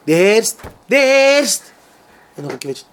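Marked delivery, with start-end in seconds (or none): 0.1-1.6 loud, high alarmed cry, rising then falling; alarmed shout. Retold or imitated. Retold alarm in story.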